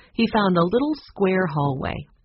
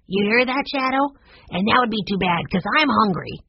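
put-on high, lively little-boy voice; young boy Benny; 5 semitones higher than usual. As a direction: play an eager boy talking to his dog.

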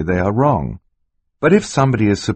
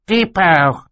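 put-on high baby squeal; baby shriek; 12 semitones higher than usual. A narrator's reading.

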